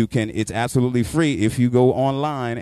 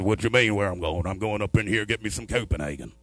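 lower, drawling country voice; lightly voiced country driver; 3 semitones lower than usual. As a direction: play the irritated hillbilly driver.

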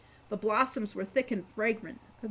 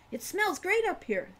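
higher, lightly voiced young girl; a delighted little girl in the treetop; Wren's childlike voice; 10 semitones higher than usual. A narrator's reading. Child Wren voiced by narrator.

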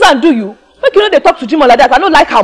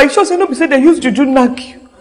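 ordinary delivery

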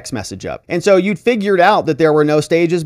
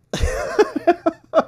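amused laugh. Real, not acted. Commentator laughs at the shouting.

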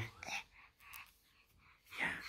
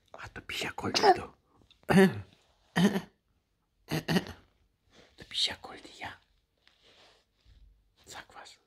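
hushed tender whisper; 10 semitones higher than usual. Real, not acted. Whispering compliments to baby.

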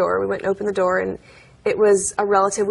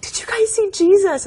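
put-on excited child; 12 semitones higher, 3 dB louder than usual. Child bursting out to parents.

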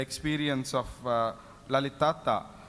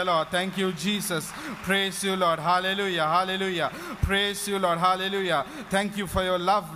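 rapid run of praise exclamations; fervent praise; faster than usual. Real, not acted. Preacher leading the congregation in praise to the Lord, fervent. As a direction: pour out praise one phrase after another.

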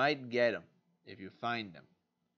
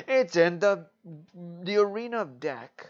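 excited pitch; 6 semitones higher, 10 dB louder than usual. Real, not acted. Excited card recommendation.

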